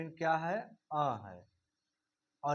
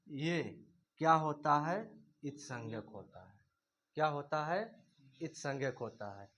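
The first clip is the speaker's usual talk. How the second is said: ordinary talk, nothing marked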